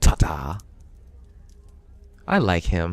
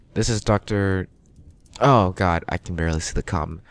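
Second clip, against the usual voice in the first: ordinary delivery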